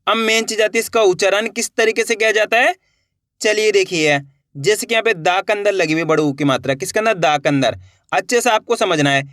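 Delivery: usual speaking voice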